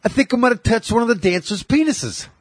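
high, sheepish wife voice; wife quoted. Retold or imitated. Wife confessing to Bill Burr in a high mock voice. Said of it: "she says".

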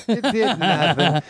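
high, slow laughing repeat; laughing disbelief. Shown as a demonstration.

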